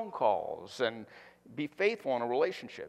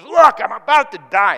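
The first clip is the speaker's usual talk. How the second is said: much louder, melodramatic whine rising on Look; drama queen; 8 semitones higher, 16 dB louder than usual. Acted. Pastor repeating Esau's melodramatic claim, wailing. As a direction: overplay the dying act. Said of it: "into the drama queen".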